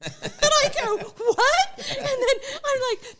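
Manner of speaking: laughing in disbelief, high and loud; real laughing shock. Real, not acted.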